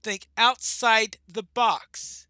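slow, loud and high, each word stretched; dramatic loud quote. Retold or imitated. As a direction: boom the hint out slowly. Quoting the game's hint, dramatized.

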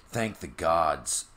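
ordinary delivery

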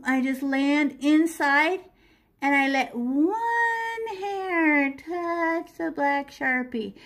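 slow, deliberate repeat; walk the student through it slowly; drawn-out instruction; slow demo again. Shown as a demonstration.

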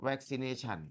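"vaccination" said softly, clearly, with a falling end; teacher models "vaccination" softly; pronunciation model. Shown as a demonstration. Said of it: "อ่านว่า vaccination".

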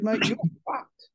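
lightly voiced friend laying it down; quoting advisor. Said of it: "my spiritual advisor said".

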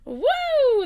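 loud high "woo"; overwhelmed whoop. Real, not acted. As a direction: let out a big, high whoop of release. Overwhelmed after intense video.

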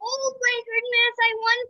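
very high, shrieking disbelief; shrieking jackpot winner. Real, not acted. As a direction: scream with joy at winning. Winning the game.

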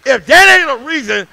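loud preaching climbing to shouts; preaching at full force. Acted. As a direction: whoop it up to the congregation. Preacher final shouting build.

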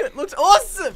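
loud, high, through laughter; laughing excitement. Real, not acted. Player praising the winning shot, laughing excitement. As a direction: laugh while gushing about the shot.

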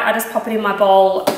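ordinary talk

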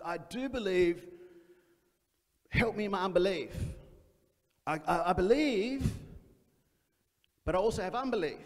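a hint of a desperate father; pleading confession. Father's honest plea voiced.

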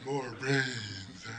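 low, quiet zombie groan; hushed, low comic zombie voice. Acted. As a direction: moan it softly like the undead. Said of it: "how about a zombie".

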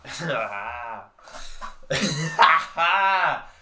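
loud burst of laughter. Real, not acted. Vlogger cracks up after greeting viewers, loud laugh.

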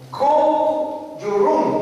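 slow, high firm English order; English command to a dog. Retold or imitated. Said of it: "así en inglés". (orden lenta y aguda en inglés al perro)

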